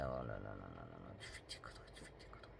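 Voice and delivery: whispered grumble at her own mistake; muttered whisper. Real, not acted. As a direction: mutter it to yourself, exasperated.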